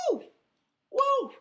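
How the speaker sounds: high, loud woo; excited whoop. Retold or imitated. Describing entering the trip, a high loud whoop. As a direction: whoop like going warp speed.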